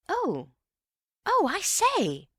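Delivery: Bill lighting up at the idea, slow and high; excited. Acted. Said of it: "Bill was rather excited again".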